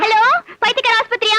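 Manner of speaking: high, put-on phone voice; mock phone call. Wife bluffing a call to the mental hospital, mock phone voice. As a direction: pretend to ring the asylum.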